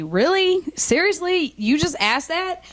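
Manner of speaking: can't believe she asked that; incredulous. Retold or imitated. Comic incredulous retort.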